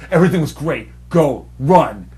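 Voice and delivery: loud, punched parting words; urgent shooing. Retold or imitated. Son grabbing his mother to leave, retold as urgent shooing. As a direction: imitate someone hurrying a relative away. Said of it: "just like that".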